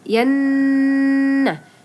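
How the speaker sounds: "Yannah" slow with the nn hummed for two to three beats; gunnah demo. Shown as a demonstration. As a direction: hum the "nn" of "Yannah" slowly. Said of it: "harus dengungkan ya, dua sampai tiga harokat".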